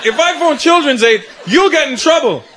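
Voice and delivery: high, shouted protest; panicked kid. Retold or imitated. Boy panics when dad offers the phone, high and shouting. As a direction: shriek the warning as the bluff collapses.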